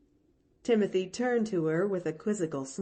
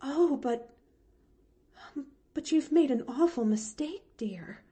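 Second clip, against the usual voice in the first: faltering; 7 semitones higher than usual. Acted. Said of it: "she faltered".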